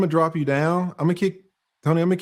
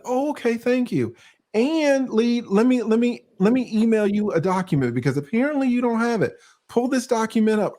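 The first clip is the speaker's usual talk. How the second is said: much higher, sweetly obliging voice; mock-accommodating self quote; 10 semitones higher than usual. Host acting out how he thanks a correcting guest, much higher and sweet. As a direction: sound endlessly helpful, pitched way up. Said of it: "And I'd be like".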